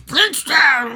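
put-on very high drunken wail; slurred holler that cracks way up high; addled drunk. Host imitates the hobo yelling at Fred, addled drunken wail. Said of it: "he sounds like a addled drunk".

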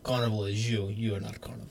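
soft cartoon Russian villain accent; understated Russian accent as a joke; mock Russian accent. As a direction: softly play a cartoon Russian baddie. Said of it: "like the moose and squirrel guy".

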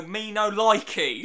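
loud, slow pidgin English; mock tourist refusing the local food, loud and slow; mock ignorant tourist. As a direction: point and bellow like a rude tourist. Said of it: "you point at their local food and you go".

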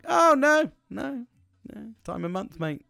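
high, loud mock-knowing wife voice; wife's knowing reply. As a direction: toss it off like it's obvious. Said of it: "She was like".

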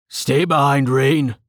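shouted battle order, slightly held back; shouted warning. A narrator's reading. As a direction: call out a warning over a fight. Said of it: "Tilson shouted".

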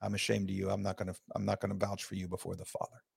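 hushed divine rebuke; solemn judgment. Retold or imitated. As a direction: say it quietly like a grave verdict. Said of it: "when he says".

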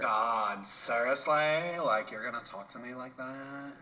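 stretched-out mock Valley Girl. Mimicking a woman to the host, Valley Girl voice. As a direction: drag "seriously" out like a Valley Girl. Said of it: "No, see, that's Valley Girl".

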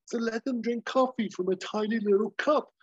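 smug over-the-top pitch; Hawk explaining. Retold or imitated. Hawk explaining his coffee plan, hammed high. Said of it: "And Hawk's like".

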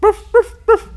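high, punchy mock barks of ruff, ruff, ruff; imitating a barking man. Telling a friend about the inbred man, mock barking. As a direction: bark out ruff, ruff, ruff like the man who talks that way. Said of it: "he barks".

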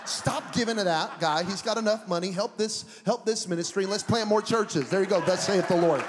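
loud, high preaching command. Acted. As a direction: command it like a prophet.

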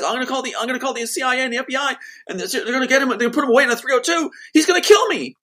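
fast, very high frantic threats; manic screaming. Retold or imitated. Sister threatening to call the FBI, manic screaming. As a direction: imitate hysterical yelling, rising to a loud peak. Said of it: "screaming in a manic way".

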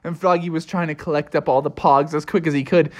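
plain reading voice

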